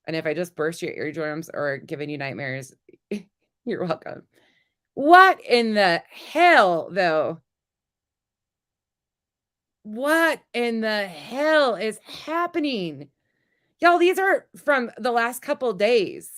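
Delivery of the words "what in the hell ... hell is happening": very slow, drawn-out, loud incredulous whine; impression of Molly. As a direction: stretch every word in her voice. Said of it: "That was spot on".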